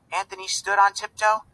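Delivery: ordinary delivery, nothing marked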